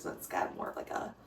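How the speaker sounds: whispered aside; whispering. Real, not acted. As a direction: breathe the words without voice. Whispered aside while cooking.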